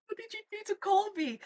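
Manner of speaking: high, loud indignant cry; shrieking in playful outrage; mock outrage.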